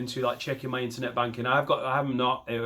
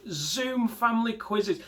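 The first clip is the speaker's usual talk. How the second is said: higher, slow, incredulous repeat; disbelief; 9 semitones higher, slower than usual. Real, not acted. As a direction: say it like you can't believe it's real.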